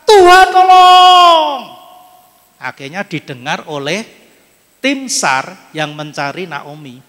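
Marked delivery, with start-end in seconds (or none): 0.0-1.6 loud, high, slow cry for help; long drawn-out shout, much higher; desperate shout. Retold or imitated. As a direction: scream it out from the bottom of the ravine. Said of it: "dia teriaknya lebih keras".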